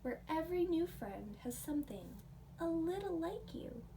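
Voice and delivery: plain reading voice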